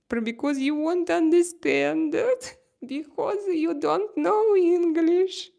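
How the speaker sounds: high, loud taunting comeback; mocking retort. Real, not acted. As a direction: throw it back at the critic, high and loud. Mocking comeback to critical viewer.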